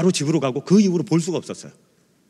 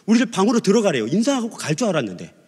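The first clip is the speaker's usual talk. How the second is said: ordinary talk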